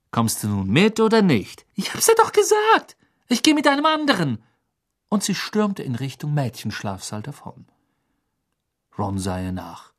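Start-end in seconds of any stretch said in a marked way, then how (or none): 1.7-4.4 high, heated cry of exasperation; exasperated outburst. A narrator's reading. Hermine refuses Ron once more before storming off, exasperated. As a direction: snap it out at the top of your patience.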